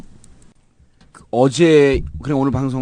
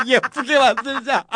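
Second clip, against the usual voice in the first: giggling through the words; laughing while repeating it; 8 semitones higher than usual. Retold or imitated.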